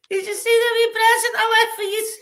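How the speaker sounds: loud and high, calling out cheekily; prisoner shouting. Retold or imitated. Prisoner shouting proudly to the cleaner, high and loud. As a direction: yell it through the wall with glee. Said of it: "he's shouting there".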